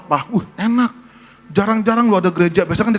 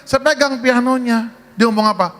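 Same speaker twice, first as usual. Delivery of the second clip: ordinary delivery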